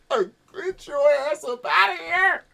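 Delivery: high, slow jeering yell; mock heckle. Graduate heckling the old speaker, mock yell. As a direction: shout him off the stage.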